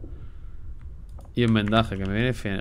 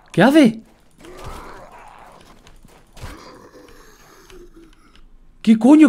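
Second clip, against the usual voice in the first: loud, high outraged yells; furious shouting; 13 semitones higher, 7 dB louder than usual. Real, not acted. Gamer finding the base raided, real furious shouting. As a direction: scream at whoever wrecked the base.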